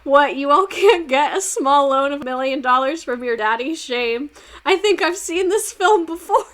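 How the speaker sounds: high, loud smug rich guy; hammed-up smug politician voice; mock Hoover. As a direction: sneer like a spoiled president. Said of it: "He said".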